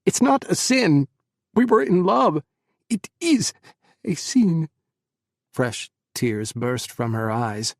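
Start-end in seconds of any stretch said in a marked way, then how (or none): 0.0-4.7 much higher and louder, shouting back; heated argument. Acted. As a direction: fight for it. Heated argument over sin.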